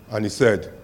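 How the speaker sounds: normal talking voice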